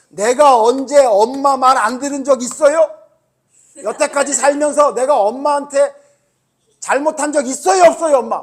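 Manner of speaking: high, loud protest to mom, acted out; re-enacted outburst at full volume; tearful yelling, imitated. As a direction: shout it like a wronged kid retelling a tantrum. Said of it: "펑펑 울면서 뭐라 그랬냐면".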